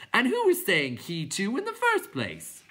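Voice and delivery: high, loud clown question; clown creature voice. A narrator's reading. Clown asking the others why, high and loud. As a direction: ask it in a silly high voice.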